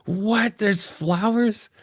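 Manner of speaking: stunned, can't believe there are flowers; amazed disbelief. Retold or imitated. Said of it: "And then I lost my mind".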